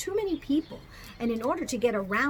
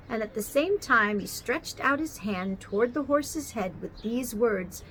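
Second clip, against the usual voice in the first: plain reading voice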